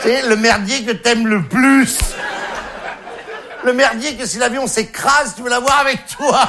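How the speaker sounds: high, slow, mounting emphatic plea; mock-passionate. Comedian on the junk you'd crash with, mock-passionate. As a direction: stretch it out with hammed-up devotion.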